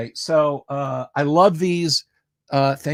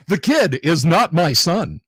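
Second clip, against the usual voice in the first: raised, punchy Shorts narrator; YouTube Shorts voice; 4 semitones higher, 5 dB louder than usual. Shown as a demonstration. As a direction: deliver the lyric like a loud short-video host. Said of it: "in my YouTube Shorts voice".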